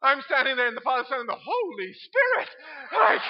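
loud, fast, high; excited. Real, not acted. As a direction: blurt it out amid the laughter. Left alone mid-baptism, laughing.

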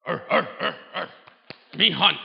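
loud mock caveman grunts; caveman grunting. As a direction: bellow like a brutish caveman. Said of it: "it isn't just about being".

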